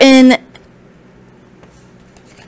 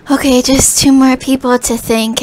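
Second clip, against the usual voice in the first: normal talking voice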